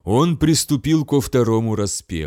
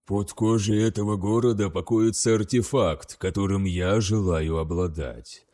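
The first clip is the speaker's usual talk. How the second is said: grim sorcerer; 4 semitones lower, 4 dB quieter than usual. Acted. Sorcerer commanding the demon.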